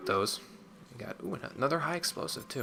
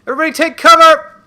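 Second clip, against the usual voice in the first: much higher and louder shout; 20 dB louder than usual. Real, not acted. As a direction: yell the warning to the squad. Calling squad before explosion.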